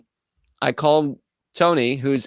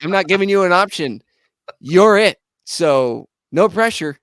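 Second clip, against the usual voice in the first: higher and louder, hyped bandleader; pumped-up order; 6 semitones higher, 5 dB louder than usual. Retold or imitated. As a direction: fire up your drummer over the phone. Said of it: "I'm like".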